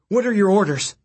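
high, loud anxious plea; urgent panting report. Acted. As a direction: demand orders in alarm. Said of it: "the messenger cried".

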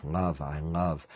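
low, slow and very soft; entranced murmur. A narrator's reading. Man entranced by the swirling colors, murmuring dreamily. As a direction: let the words sink away as if hypnotized.

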